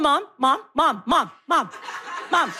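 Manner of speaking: lightly voiced son nagging on repeat; pestering child. Son pestering mom on the drive home, lightly imitated nagging. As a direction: imitate a kid badgering for attention.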